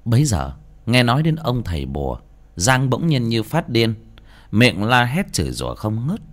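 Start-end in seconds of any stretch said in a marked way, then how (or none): none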